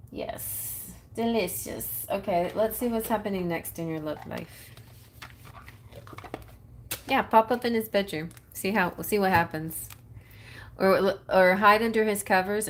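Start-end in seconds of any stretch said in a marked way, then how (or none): none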